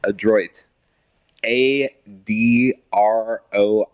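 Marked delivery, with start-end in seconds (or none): 1.4-3.9 slow, letter-by-letter spelling. Shown as a demonstration. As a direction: spell each letter carefully.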